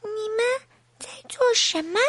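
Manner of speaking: put-on high, hushed baby voice; small, quiet high-pitched question; soft asking. A narrator's reading. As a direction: ask softly as a little baby. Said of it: "宝宝轻声问".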